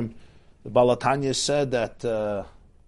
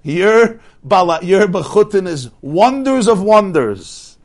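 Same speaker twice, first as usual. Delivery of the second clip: much louder, higher and slow; fervent praise; 6 semitones higher, 9 dB louder, slower than usual. Acted. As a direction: savour each word in awe. Translating the coachman's praise.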